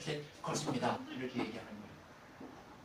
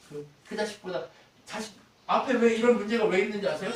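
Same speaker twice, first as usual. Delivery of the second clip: normal talking voice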